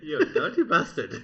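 loud, drawn-out laughing jibe. Real, not acted. Co-host teasing friend over a crude joke, laughing. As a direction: crack up while scolding your mate.

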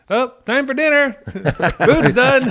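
high, loud singsong call to dinner; mock family yell with laughter breaking in. Family joking over the smoke alarm, yelling. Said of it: "everybody would start yelling".

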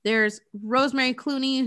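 normal talking voice

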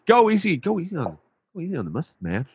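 put-on easy-going New York accent. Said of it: "New York accent".

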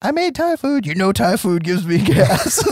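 high-pitched puppet voice for a wife; sock-puppet wife. Acted. Puppet role-play of bickering wife.

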